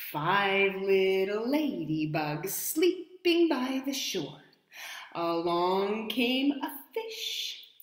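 slow, lilting rhyme; reading to children. Acted. Storyteller reading the next verse slowly to kids. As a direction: ease through the verse slowly.

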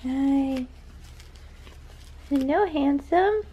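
high slow cooing. Real, not acted. Mother coos at baby.

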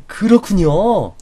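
high, slow, drawn-out 그렇군요; exaggerated understanding; overdone agreement. Shown as a demonstration. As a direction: stretch 그렇군요 high and long to show you're impressed. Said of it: "좀 오버해줄 필요가 있습니다".

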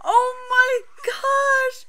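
very high, slow shrieked disbelief; drawn-out scream. Real, not acted. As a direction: stretch out a horrified shriek. Screaming in disbelief at gross scene.

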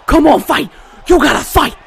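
high, loud urging yell; urgent shouting. Real, not acted. Gamer to own struggling fighter, urgent shout. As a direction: spur the fighter on at full voice.

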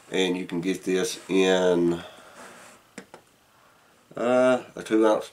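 talking around pipe stem; speaking with clenched teeth; pipe in mouth. Real, not acted. Seen 0: Pipe stem held in mouth throughout, gone by 12.6.

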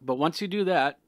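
normal talking voice

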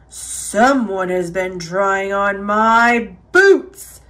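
low, slow booming bear; Papa Bear. A narrator's reading. Papa Bear finding his boots moved, booming. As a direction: boom it out in a big bear voice. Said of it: "he boomed in his big bear voice".

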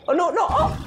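high-pitched, dismayed squeak; playful panic. Real, not acted. Player watching a mishap with the Korok, high alarmed squeak.

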